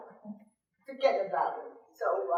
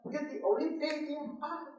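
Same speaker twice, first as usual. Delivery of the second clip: ordinary talk, nothing marked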